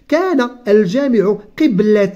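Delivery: slow, careful reading of a textbook passage; reading aloud. Acted. Teacher reading the lesson text slowly to the child. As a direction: read each word out slowly for the pupil. Reads the exercise sentence.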